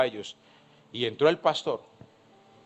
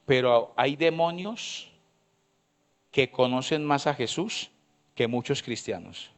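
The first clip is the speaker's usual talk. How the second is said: slow, deliberate words, each one laid down; slowly and clearly; 5 dB quieter, slower than usual. Shown as a demonstration. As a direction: spell it out slowly for the congregation. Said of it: "va a tratar de decirlo despacio y con buena letra". (despacio y con buena letra)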